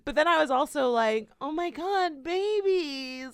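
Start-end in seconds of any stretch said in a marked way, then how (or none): 1.4-3.3 high-pitched, melting swoon; mock tearful gushing. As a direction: coo as if about to cry over babies. Acting out swooning over babies.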